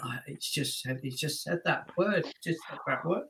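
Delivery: very soft, dazed repetition; stunned undertone. Retold or imitated. Performer frozen after kid's answer, stunned undertone. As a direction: say it under your breath, stunned. Retells frozen shock on stage.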